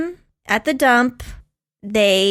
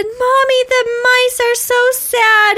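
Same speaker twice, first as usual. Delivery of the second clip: put-on high, small child pleading to mommy; little boy's voice; 12 semitones higher, 3 dB louder than usual. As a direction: voice a sad little boy, much higher. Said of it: "woke me up and said".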